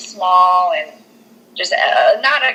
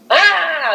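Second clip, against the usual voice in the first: high mock scream, sudden; playful jump-scare "ah"; imitated ghost scream; 10 semitones higher than usual.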